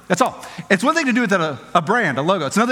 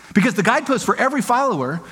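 ordinary talk

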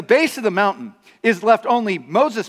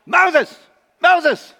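higher, louder call of the name; calling out for Moses; 9 semitones higher, 4 dB louder than usual. Retold or imitated. Israelites anxiously calling for Moses, higher and louder.

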